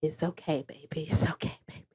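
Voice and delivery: put-on hushed, comforting a distraught child; soft soothing whisper. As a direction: whisper it like calming a crying kid. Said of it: "she's like".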